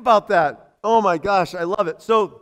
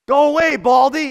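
higher, louder schoolyard taunt; mock taunting yell; 4 semitones higher, 6 dB louder than usual. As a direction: jeer like the naysayers. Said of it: "and they're yelling".

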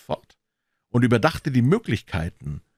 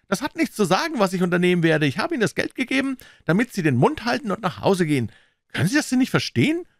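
fast, high-pitched scolding; exasperated, pressing businessman; impatient banker; 12 semitones higher, 4 dB louder, faster than usual. Acted. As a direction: cut him off and drive the order home.

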